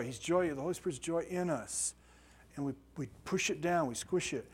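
normal talking voice

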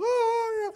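long high, loud mock wail; imitated alarmed cry. Daughter watching the fall, imitated long high wail. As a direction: stretch out a hammed-up cry of alarm.

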